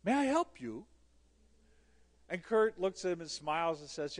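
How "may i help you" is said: lightly imitated lilting European accent, higher and louder; a hint of a polite European accent. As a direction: offer help with a slight European lilt. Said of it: "he said in this lilting European accent".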